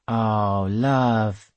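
a lightly voiced old seaman; old sailor. A narrator's reading. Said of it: "said the sailors".

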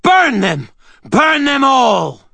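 high shrieked command, the last slowed; shrieking. A narrator's reading. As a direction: shriek it with mad fury. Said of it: "Tessa shrieked".

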